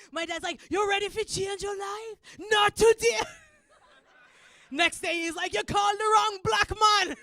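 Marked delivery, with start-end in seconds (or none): 0.7-3.2 loud, high Jamaican dad impression; Jamaican accent. Dad to his son on the phone, Jamaican-accented shout. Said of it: "This is Jamaican accent". 5.5-7.2 high, shouted Jamaican dad impression; Jamaican accent. Dad to the prison callers, defiant Jamaican shout. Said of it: "This is Jamaican accent".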